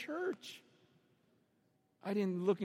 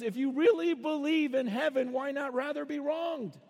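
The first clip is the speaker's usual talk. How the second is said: higher, urgent pleading question; raised, insistent appeal; impassioned challenge; 5 semitones higher, 3 dB louder than usual. Acted. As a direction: press the argument in one driving run. Acts out Paul's heated challenge.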